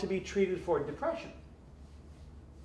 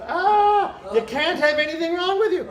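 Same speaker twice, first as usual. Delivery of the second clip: mock outraged public; 12 semitones higher, 9 dB louder than usual. Mocking society's voice.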